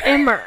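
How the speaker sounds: Emmer echoed with an R on the end; mocking R ending. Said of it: "they end, like, some words with an R".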